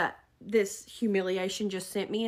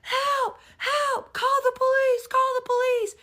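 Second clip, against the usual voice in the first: loud cry for help; 14 semitones higher, 5 dB louder than usual. Retold or imitated. Unseen shopper calling for help, imitated loud yelling. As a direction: imitate a high, urgent shout. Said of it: "Loud, they're like".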